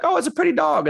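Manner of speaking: swooning over a cute puppy; mocking people who fall for pretty dogs; naive admiration. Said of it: "people are like".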